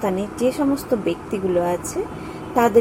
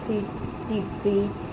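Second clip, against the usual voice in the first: softer, slower voice with long pauses between phrases; guided meditation; 3 semitones lower, 9 dB quieter than usual. Acted. Guide leads listeners in thanks and divine healing, meditative. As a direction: lead listeners gently through gratitude and prayer, leaving space.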